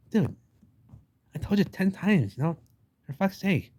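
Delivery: half-hearted exasperated rant; fed-up dude. Retold or imitated. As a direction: whine it like a worn-out man who's had enough. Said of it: "he's just like".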